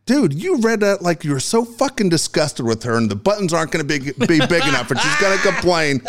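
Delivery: high-pitched exasperated rant; imitated angry coach. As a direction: mimic a coach tearing into a bad read. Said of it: "You sound so fucking angry".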